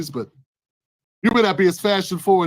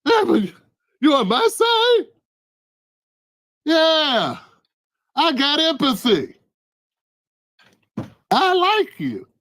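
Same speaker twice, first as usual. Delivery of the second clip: high pleading; 7 semitones higher, slower than usual. Acted. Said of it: "victim asks, invader answers".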